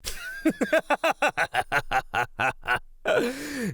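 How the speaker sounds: long, high-pitched cackle; delighted predator laughing at length; gleeful villain cackle. Acted. Predator delighted by the captive's answer.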